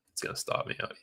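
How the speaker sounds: whispered dread at the screen; under the breath, bracing for loss. Real, not acted. Watching the trade fail.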